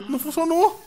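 high-pitched gasp of surprise; gasp, then say it's broken; surprised gasp. Real, not acted. Jogo falhou, espanto. (espanto com suspiro)

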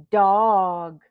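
usual speaking voice

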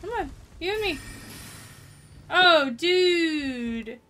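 loud nervous taunting; false bravado. Real, not acted. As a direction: act brave while scared. Taunting boss while scared.

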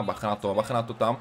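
usual speaking voice

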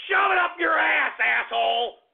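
real scorn, voice jumps up; angry outburst. Real, not acted. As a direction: bark it at the troll. Host snaps at rude caller name.

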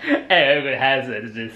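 imitating a posh English accent; teasing upper-class English imitation; mock posh. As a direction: mimic it in a plummy posh voice. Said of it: "sounded so posh".

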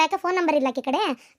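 ordinary talk

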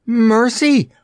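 high, loud startled cry; little girl startled by the dummy; startled stutter. A narrator's reading. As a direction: blurt it out in fright. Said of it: "stuttered the little girl".

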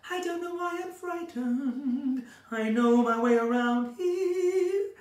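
slow, high, half-sung faded diva; Norma Desmond. Shown as a demonstration. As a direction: croon it slowly like a frightened old screen star. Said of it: "Norma Desmond, Sunset Boulevard".

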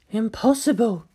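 a touch of startled scientist; gasped disbelief. Acted. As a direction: gasp it in shock. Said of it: "Ritsuko gasped".

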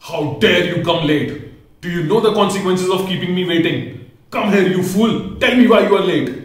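high, loud lion's roar building to a yell; lion raging at the late rabbit; furious shout. A narrator's reading. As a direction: shout him down like an enraged king. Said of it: "The lion shouted at the rabbit".

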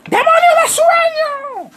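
high, loud preacher's shout, slowed; hammed-up preacher yelling at a sleeping boy. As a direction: cast out the demon like a fired-up preacher. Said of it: "le dijo el predicador".

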